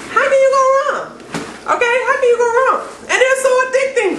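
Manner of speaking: high, fast gushing about the chips; excited. Real, not acted. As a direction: rave like you can't contain it. Raving about kale chips.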